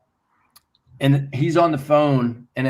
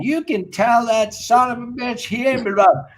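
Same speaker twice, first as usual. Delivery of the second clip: mock scream; 9 semitones higher than usual. Manager on the other end yelling about Anders, mild mock yell. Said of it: "my manager on the other end is screaming".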